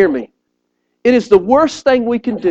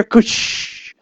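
imitated gush; 6 dB quieter than usual. Sound of toilet flooding.

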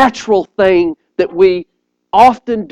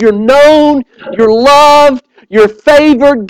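proclaiming; 3 semitones higher, 4 dB louder, slower than usual. Acted. Angel's words proclaimed.

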